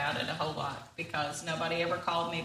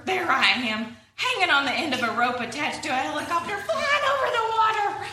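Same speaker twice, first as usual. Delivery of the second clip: higher and louder as the disaster fantasy builds, half laughing; worried Walrus spiraling; 7 semitones higher, 4 dB louder than usual. Acted. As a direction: let the panic climb line by line into hammed-up frenzy.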